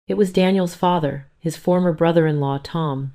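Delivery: ordinary delivery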